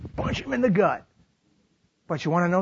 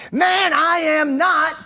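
indignant denial; 9 semitones higher, 5 dB louder, slower than usual. Acted. Peter's second denial.

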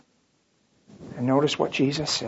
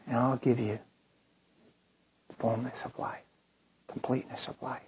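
soft whispered promise, much quieter; hushed whisper; 4 semitones lower, 12 dB quieter than usual. Acted. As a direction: lean in and whisper it gently. Jesus speaking tenderly.

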